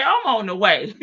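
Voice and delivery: lighter take, laughing through it; amused retelling. Retold or imitated. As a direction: repeat it while cracking up. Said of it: "Mama say".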